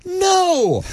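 high, incredulous outburst; indignant. Real, not acted. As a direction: scoff in disbelief at the idea. Indignant reply to rival fans.

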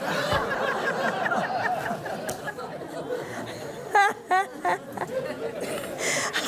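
a long, high, delighted laugh; real amused laughter. Real, not acted. Laughs at her own story.

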